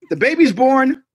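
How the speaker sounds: ordinary delivery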